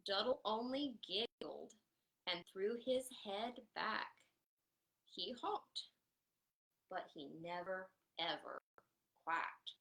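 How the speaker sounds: lively picture-book reading; animated storytime narration; reading to children. A narrator's reading. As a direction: read it out to a circle of little kids.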